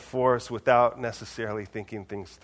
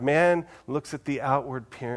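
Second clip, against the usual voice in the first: usual speaking voice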